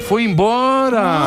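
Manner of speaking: high, drawn-out shout; mock announcer call. Host announcing a departure on air, drawn-out call. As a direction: stretch it out like a play-by-play cry. Calling out that someone left.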